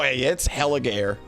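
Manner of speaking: normal talking voice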